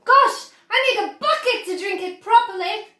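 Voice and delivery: high, loud greedy exclamation; greedy excitement. Acted. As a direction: gush over the chocolate. Greedy boy at the river.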